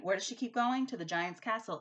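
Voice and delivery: ordinary talk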